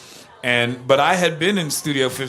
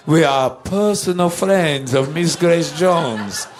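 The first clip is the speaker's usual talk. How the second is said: put-on slower, higher, emphatic Dietrich-meets-Lugosi accent; insistent put-on [name] accent; 4 semitones higher, 3 dB louder, slower than usual. Musicians insisting to the doorman, in exaggerated borrowed accent. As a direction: insist grandly in the singer's peculiar accent, stretching the words. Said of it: "we had to put on the accent".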